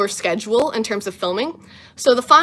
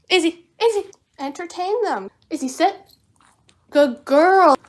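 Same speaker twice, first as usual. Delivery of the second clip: higher sing-song dog talk; pet voice; 6 semitones higher than usual. Real, not acted. Owner coaxes her dog Izzy, high pet voice. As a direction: coax the dog, high and sweet.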